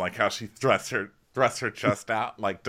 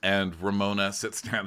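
usual speaking voice

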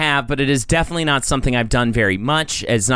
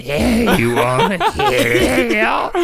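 mocking, drawn-out gremlin voice; slow hammed-up monster imitation; 7 semitones higher, much slower than usual. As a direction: stretch it out like a creepy gremlin, breaking into laughter. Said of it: "You're talking to him like a gremlin".

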